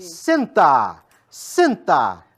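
loud, forceful dog command; strong command. Shown as a demonstration. Trainer commanding the dog firmly to sit. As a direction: bark the command out so the dog obeys. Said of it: "por entonação mais forte".

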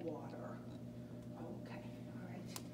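breathy whispered okay while serving. Pretend. Flight attendant serving meals, whispered aside. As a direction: murmur it under your breath.

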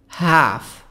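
HALF with the American front A of 'cat'; American-accented 'half'; American pronunciation. Shown as a demonstration. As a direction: say HALF as 'haff', drawn out, American style. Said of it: "النطق البريطاني".

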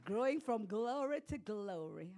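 normal talking voice

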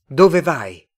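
forceful puzzled question; called-out question. A narrator's reading. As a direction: call it across to a stranger. Both call questions at each other.

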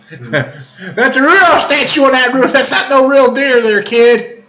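high, drawn-out comic objection; hammed-up correcting a kid; acted protest. Acted protest about roof statue.

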